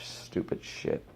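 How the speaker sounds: soft unvoiced mutter of disgust. Real, not acted. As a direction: spit it out quietly. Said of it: "muttered under breath".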